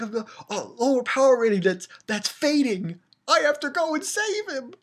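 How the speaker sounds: high, hammed-up skit urgency; mock anime heroine. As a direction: overact a hero rushing to the rescue. Mimics Dragon Ball parody skit.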